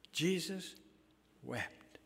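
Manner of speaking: Jesus wept, dropping to a hush on wept; solemn slow repeat. Shown as a demonstration. Preacher repeating the verse, hushed. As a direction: let wept fall away quietly.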